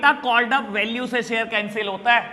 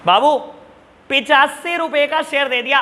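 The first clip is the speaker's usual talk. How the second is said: usual speaking voice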